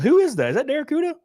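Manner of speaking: high, loud surprised double take; startled recognition. Real, not acted. Streamer spots an old account on screen, surprised.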